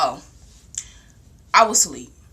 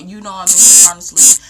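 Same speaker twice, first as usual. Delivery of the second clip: loud, annoyed at being woken; irritated yell; 11 dB louder than usual. Retold or imitated. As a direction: snap back while half asleep. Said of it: "I'm like".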